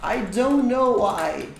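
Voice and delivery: acted reply. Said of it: "Aí a pessoa responde".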